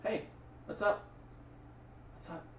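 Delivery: hushed little greeting with a shh; playfully quiet. Pretend. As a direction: pretend to whisper hello. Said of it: "Gotta say it quietly".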